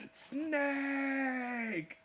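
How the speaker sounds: thrilled yell; excited shout. Real, not acted. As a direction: call out the name in delight. Excited calling out the character.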